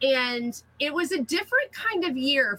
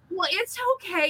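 high, gentle it's okay; kind reassurance; 8 semitones higher than usual. Retold or imitated. Karen gently reassures the embarrassed winner, high and kind. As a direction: soothe her embarrassment lightly. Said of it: "she was like".

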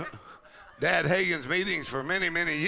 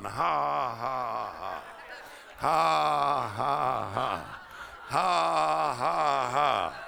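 slow, spaced forced ha-ha laugh, falling away; drawn-out mock laugh in sets of three; laughing on purpose; 3 semitones lower, much slower than usual. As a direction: imitate a deliberate, joyless-start laugh. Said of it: "he just started laughing on purpose".

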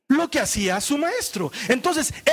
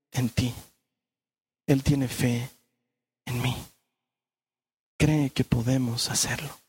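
tender sermon close; 7 semitones lower than usual. Acted. Tender closing preaching.

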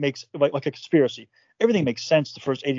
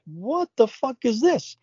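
much higher, slow and drawn out; incredulous; 8 semitones higher, slower than usual. Retold or imitated. Seeing the monthly charges again, incredulous. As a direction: stretch it in disbelief.

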